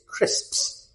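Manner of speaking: CRISPS with both S sounds and the P clear; careful pronunciation. Shown as a demonstration. Teacher models a hard word for Thai learners, carefully pronounced. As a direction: say CRISPS with the full final S-P-S cluster. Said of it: "most difficult words for Thai learners to pronounce".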